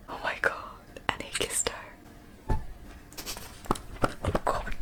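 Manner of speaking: breathless whispered swoon; awed whisper. Real, not acted. Reader swooning at a kiss scene, whispered. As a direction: whisper it like you can't handle the scene.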